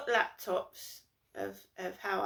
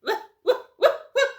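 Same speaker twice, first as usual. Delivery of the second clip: posh retching noises; 14 semitones higher, 12 dB louder than usual. Retold or imitated. Mocking posh disgust noise.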